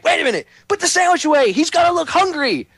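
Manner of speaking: high, strained producer panicking off camera; hurried high-pitched comic yelp; Sam Kinison. Retold or imitated. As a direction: imitate a frantic comedian barking orders. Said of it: "Like Sam Kinison used to joke about".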